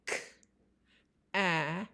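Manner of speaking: K-at with the k split off from at; segmented. Shown as a demonstration. Teacher breaking cat into sounds for a child. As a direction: separate the k sound before at. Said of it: "as you say each sound".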